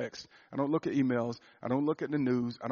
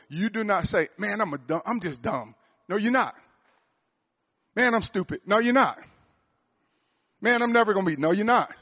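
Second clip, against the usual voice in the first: louder and higher, firm correction; forceful rebuke; 6 semitones higher, 5 dB louder than usual. Acted. Preacher to his boys, rejecting their self-insults loudly. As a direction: stamp out each self-insult.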